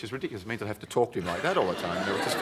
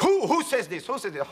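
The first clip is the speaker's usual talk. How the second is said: mock indignant; 9 semitones higher, 5 dB louder than usual. Nureyev voiced, indignant.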